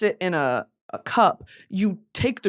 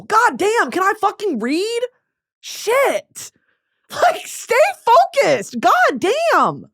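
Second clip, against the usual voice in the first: high, loud frustrated ranting; annoyed outburst; 11 semitones higher, 5 dB louder than usual. Real, not acted. Losing focus while reading, venting at viewers in loud frustration. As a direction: blow up at yourself for losing focus. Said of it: "I'm fucking annoyed".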